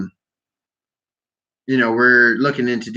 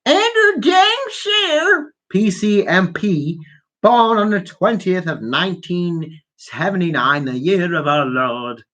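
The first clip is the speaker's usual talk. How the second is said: exaggerated slow read, starting very high; drawn-out put-on voice sliding down to usual pitch; mock biography reading; 4 semitones higher, slower than usual. Podcaster reading a politician's wiki page, in a mock grand voice.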